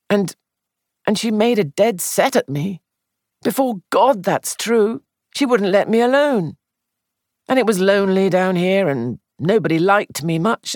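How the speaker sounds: embarrassed, halting admission; uneasy young man. Acted. Sandford's uneasy confession.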